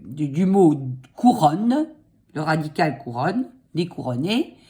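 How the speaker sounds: ordinary delivery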